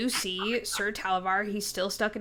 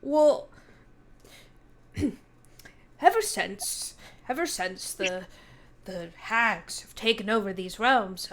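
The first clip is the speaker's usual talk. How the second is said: lightly voiced fae knight, worried; a hint of a fretful knight; 6 dB louder than usual. Knight explains the hags to the party, lightly worried.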